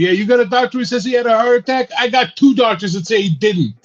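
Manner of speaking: high, loud, fast mock phone rant; imitating a yelling director. Director yelling into the phone on set, hammed-up shout. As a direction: bark it down the phone like a furious director. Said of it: "Coppola's on the fucking phone yelling".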